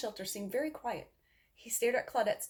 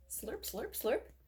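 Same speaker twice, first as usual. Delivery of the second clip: higher, playful slurping dog noises; 5 semitones higher, much slower than usual. Acted. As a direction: slurp it like a dog licking its nose. Dog licking its nose.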